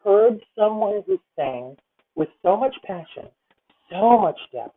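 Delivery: ordinary talk, nothing marked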